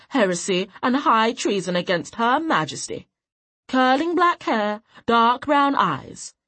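loud, high herald's proclamation; wanted poster proclamation. Acted. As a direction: read it out like a town crier. Reading out wanted notice.

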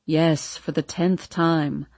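low, weary irritation; annoyed Hardin. A narrator's reading. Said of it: "clearly annoyed".

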